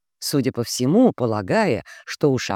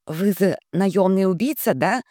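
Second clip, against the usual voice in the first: slushy lisp; 5 semitones higher than usual. Acted. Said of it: "чуть шепелявя произнесла".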